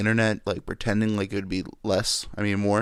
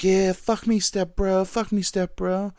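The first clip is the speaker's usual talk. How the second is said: mock porn moan; 10 semitones higher than usual. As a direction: ham up a cheesy porn actress begging. Mocking porn actress lines.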